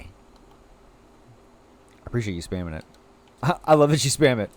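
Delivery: ordinary talk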